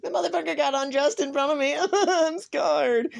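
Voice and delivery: very high, loud, fast put-on voice; hammed-up squeal of imitated horror; mock scandalised woman. Imagining the sandwich lady's reaction, mock scandalised squeal.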